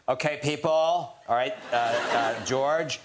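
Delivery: high, projected director over a loudspeaker; mock booming set directions, rising in pitch; giant booming director. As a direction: boom it out like a director on a microphone. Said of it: "giant booming voice".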